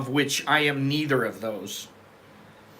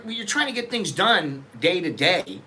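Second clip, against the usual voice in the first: ordinary delivery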